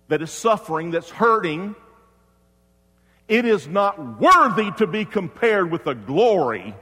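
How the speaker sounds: high, loud pulpit voice; full-force preaching. Real, not acted. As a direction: preach it out to the whole congregation.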